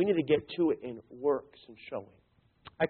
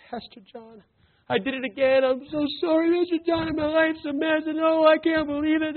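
crying plea; 10 semitones higher than usual. Retold or imitated. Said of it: "Mary began to cry".

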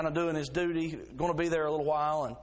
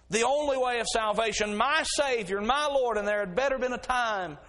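raised, louder preaching cry; 7 semitones higher, 4 dB louder than usual. Acted. Preacher proclaiming to the congregation, louder and higher. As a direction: proclaim it high and loud.

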